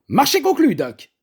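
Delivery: shrill jump up on the deal, loud and high; exclaiming. A narrator's reading. Cook accepting the meat deal, loud high exclamation. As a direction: seal the bargain with a triumphant cry. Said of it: "s'exclama-t-elle".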